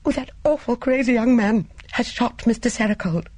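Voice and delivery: high, distressed tearful cry. A narrator's reading. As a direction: say it on the edge of tears. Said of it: "Mildred said tearfully".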